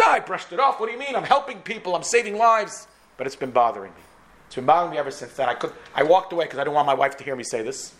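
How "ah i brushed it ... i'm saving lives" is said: high-pitched, protesting self-defense; defensive. Retold or imitated. Doctor defends his work to his wife, defensive.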